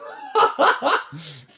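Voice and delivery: high, loud burst of laughter; laughing at the runaway boat; incredulous laugh. Real, not acted. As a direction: laugh in disbelief. Laughing at glitch.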